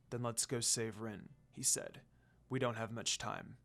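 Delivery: low, soft, cool command; a calm, quiet demon lord; Sesshomaru voice. Acted. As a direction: Sesshomaru deciding to rescue Rin, low and quiet.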